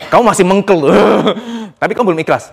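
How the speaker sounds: ordinary talk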